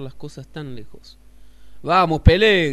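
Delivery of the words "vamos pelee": grito muy fuerte y agudo; grito de provocación. Acted. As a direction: provoca al puma gritando. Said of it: "gritó el Piojo". (el Piojo grita al puma, muy fuerte)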